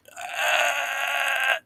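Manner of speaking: long, very high, softened squeal; stunned "uh". Retold or imitated. Retelling his speechless reply to the coworker's wife, stunned squeal. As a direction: draw out a high, strained "uhhh" as a mock reaction. Stumped reaction to her naive question.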